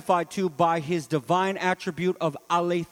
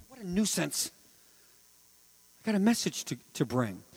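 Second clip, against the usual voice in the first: softer, offhand Paul brushing off the snake; Paul shaking off the snake, casually; mock nonchalance; 6 dB quieter than usual. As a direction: shrug it off, unbothered.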